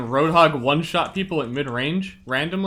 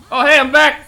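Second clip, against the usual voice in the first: excited greeting; 11 semitones higher, 11 dB louder than usual. Real, not acted. Returning to stream excitedly.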